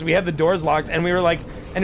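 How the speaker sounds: normal talking voice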